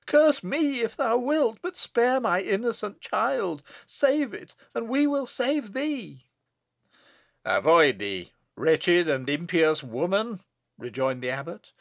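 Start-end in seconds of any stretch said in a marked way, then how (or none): 0.0-6.2 high-pitched, urgent begging; desperate plea. Acted. Bess throws herself at abbot's feet, desperate plea. Mother begs for her child. 7.4-10.4 loud, slow, commanding rejection; stern rebuke. Acted. Abbot rejects Bess's plea, stern rebuke. Abbot spurns the pleading mother.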